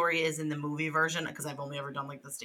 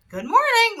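mock cheery greeting; 9 dB louder than usual. Wife acts like nothing happened.

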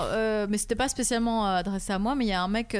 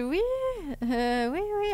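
passive-aggressive; 5 semitones higher, 4 dB quieter, slower than usual. Retold or imitated. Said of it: "avec un sourire vraiment passif-agressif".

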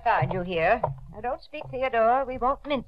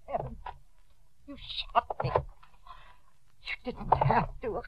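weak, slow, voiceless last words; dying gasp; 8 dB quieter, slower than usual. Acted. Aunt to the nephew who shot her, gasping and wounded. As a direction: gasp it out as strength drains away.

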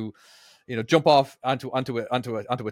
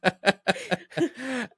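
high, real giggling; high giggle; 13 semitones higher than usual. Real, not acted. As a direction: giggle at your own story. Laughs at own remark.

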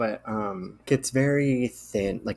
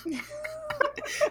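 squeaky high laugh; high burst of laughter; 6 dB quieter than usual. Real, not acted.

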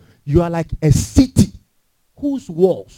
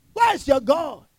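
Where's your God lifted higher; higher challenge; 6 semitones higher, 6 dB quieter, slower than usual. Real, not acted. Preacher challenging listeners, higher. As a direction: press the question sharper.

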